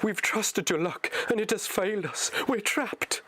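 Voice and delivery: high anguished hobbit voice rising; rising panic, trapped at last; Frodo in despair. Acted. Frodo tells Sam they are trapped, high despairing voice.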